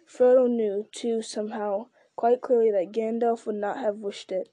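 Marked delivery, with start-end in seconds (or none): none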